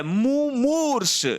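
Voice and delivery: मुमूर्ष high and slow, short मु then long मू; a teacher modelling मुमूर्ष; slow syllable demo. Shown as a demonstration. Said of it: "पहले लघु है, फिर दीर्घ है".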